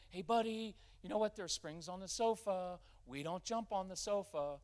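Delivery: over-explain like you're begging for buy-in; pleading buddy tone. Shown as a demonstration. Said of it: "we got a little too egalitarian with that".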